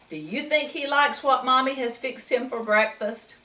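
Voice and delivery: ordinary delivery, nothing marked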